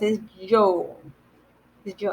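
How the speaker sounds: "yo" said like English y; double L as y. Shown as a demonstration. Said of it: "but when you have two L's".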